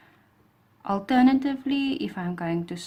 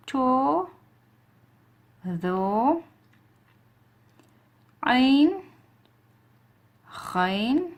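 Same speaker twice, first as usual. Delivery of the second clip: clear letter recitation; 4 semitones higher, much slower than usual. Shown as a demonstration. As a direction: recite the alphabet for students to repeat. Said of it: "repeat after me".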